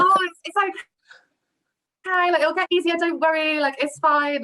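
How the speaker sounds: high-pitched, drawn-out mock comforting; exaggeratedly reassuring, lifted voice; retold reassurance. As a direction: act out soothing a nervous newcomer, starting high and easing down. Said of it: "And I was like".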